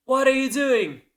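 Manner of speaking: high, loud cry of disbelief; outraged. Retold or imitated. Jacob confronts Miranda after the shooting, outraged. Replays Jacob's shocked question.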